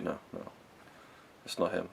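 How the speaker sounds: very soft, murmured denial; quiet, stunned refusal; hushed disbelief. Acted. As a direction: say it under your breath, not believing it. Voices her stunned disbelief.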